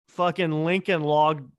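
ordinary delivery

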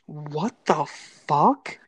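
slow, drawn-out imitated inmate; lightly voiced stunned inmate; inmate's baffled reaction. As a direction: stretch it out, baffled. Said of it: "I hear her go".

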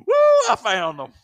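loud, drawn-out whoop of relief; triumphant whoop. Retold or imitated. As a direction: whoop it out on finding them. Re-enacts finding lost keys.

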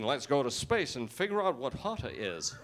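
put-on American accent; mock American accent. Americans confused by his word, mock American accent. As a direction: play the baffled American planner.

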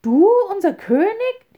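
high, loud outraged cry; enraged bird crowd; angrier shout. A narrator's reading. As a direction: yell it in fury. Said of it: "riefen die Vögel noch zorniger".

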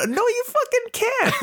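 very high, drawn-out comeback with laughter; mock outrage, squeaked slowly through a laugh; retold disbelief. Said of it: "I'm just like".

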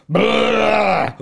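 loud high mock yell; character shout. Retelling being recognised, mock character shout. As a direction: bark it like a stage role.

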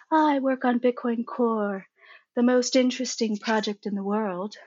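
mock soft-spoken British speaker. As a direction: put on a gentle British accent. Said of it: "in his very soft British voice".

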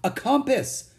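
amazed high cry; surprised. A narrator's reading. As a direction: exclaim at a discovery. Boy discovers what the box holds.

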